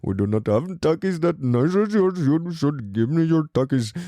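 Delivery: mock Indian-accented prison gang member; a hint of an Indian accent, teasing and demanding. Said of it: "like, India and he's like".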